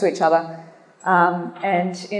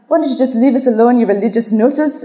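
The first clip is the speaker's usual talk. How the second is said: hammed-up irritated Irish driver; mock yell in Irish accent; 4 semitones higher than usual. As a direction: shout it out jokingly with an Irish lilt. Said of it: "just yells out".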